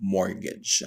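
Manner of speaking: MORTGAGE with a silent T, "MOR-gej"; correct pronunciation. Shown as a demonstration. Teacher repeating the right form for students, correct silent-T mortgage. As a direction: say mortgage with no T at all. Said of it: "That is pronounced as".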